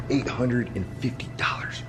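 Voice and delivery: whispered reveal; nervous night vlog whisper. Real, not acted. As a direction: breathe the price in a whisper. Whispered answer in lobby.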